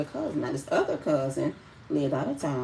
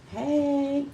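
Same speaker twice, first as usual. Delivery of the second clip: high, drawn-out hey; mock social greeting; 12 semitones higher, 4 dB louder than usual. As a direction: sing out a showy hello, much higher. Showy social media greeting.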